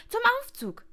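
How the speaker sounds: very high, loud shout; urgent call. A narrator's reading. Receptionist sending the guest to escape, calling urgently. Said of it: "rief der Mann von der Rezeption".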